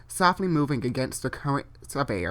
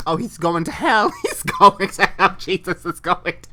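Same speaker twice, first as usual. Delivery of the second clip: laughing; 4 semitones higher, 7 dB louder than usual. Real, not acted. Laughing at own joke.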